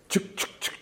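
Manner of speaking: whispered, clipped chuk chuk chuk to show a jagged shape; voiceless clicky chuk, like sharp edges; sharp chuk sounds. Shown as a demonstration. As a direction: spit out short unvoiced chuk sounds. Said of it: "mimics the inflection of the sound".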